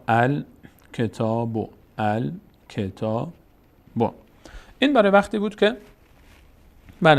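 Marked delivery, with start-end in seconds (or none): 0.1-1.7 الکتاب very slowly, with gaps between syllables; syllable-by-syllable demonstration. Shown as a demonstration. 2.0-3.3 الکتاب drawn out very slowly in separate pieces; syllable-by-syllable demonstration. Shown as a demonstration.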